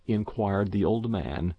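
ordinary delivery, nothing marked

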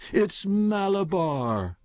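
high, drawn-out cry; a storyteller's restrained scream, pitched high and stretched; powerful strange scream. A narrator's reading. Boy screams the winner from his rocking horse, strange powerful cry. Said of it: "he screamed in a powerful, strange voice".

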